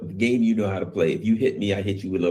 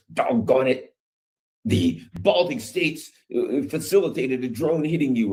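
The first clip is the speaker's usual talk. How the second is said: mock outrage; 6 semitones higher than usual. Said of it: "and they're like".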